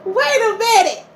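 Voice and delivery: high-pitched disbelieving laughter; incredulous laughing. Real, not acted. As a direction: laugh at how absurd the story is. Laughing disbelief at hide and seek.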